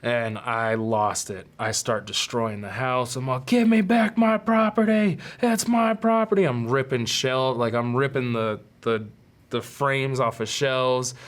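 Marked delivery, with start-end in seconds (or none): 3.5-6.4 furious shout. Retold or imitated. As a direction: yell it high and loud, demanding. Destroying house, demanding guns back.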